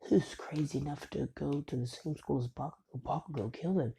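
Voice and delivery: ordinary talk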